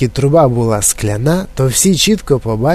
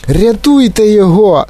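high, loud cry for help; panicked cry; 8 semitones higher, 5 dB louder than usual. A narrator's reading. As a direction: call for rescue as a panicking mother. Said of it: "поблідла пані Глуп". (панічний крик про допомогу)

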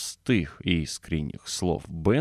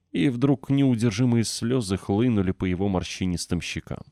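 ordinary delivery, nothing marked

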